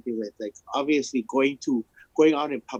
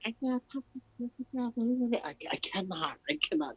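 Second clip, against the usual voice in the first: mock fussy complaint; 11 semitones higher, 7 dB quieter than usual. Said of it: "I hear every".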